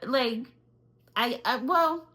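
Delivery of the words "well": ordinary delivery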